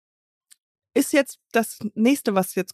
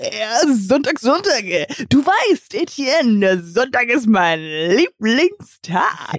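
medieval count; 2 semitones higher, much slower than usual. Pretend. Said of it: "wie so ein mittelalterlicher Graf".

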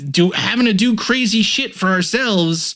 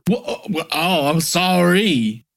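sassy Southern voice; slower than usual. Acted. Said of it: "that was my sassy Southern voice".

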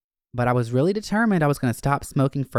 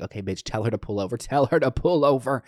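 inner panic; faster than usual. Retold or imitated. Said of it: "I was in my head like".

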